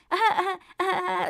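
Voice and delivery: high, mock-nervous uh-huhs, half crying; nervous whimper. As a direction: imitate her nervous whimpering. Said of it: "fucking nervous".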